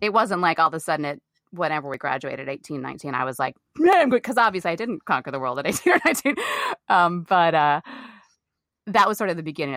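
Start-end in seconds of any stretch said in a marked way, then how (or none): 3.8-4.2 high, loud mock swagger; cocky self. Actor mocks her teenage self thinking she had made it, high and loud. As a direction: play the smug eighteen-year-old.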